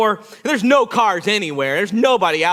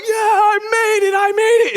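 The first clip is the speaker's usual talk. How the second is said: triumphant screaming; 13 semitones higher, 5 dB louder than usual. Retold or imitated. Climber to friends across the way, exultant shout. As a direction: holler it across to the others. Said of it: "I'm standing up there screaming".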